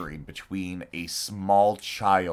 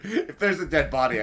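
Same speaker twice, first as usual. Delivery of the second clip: ordinary talk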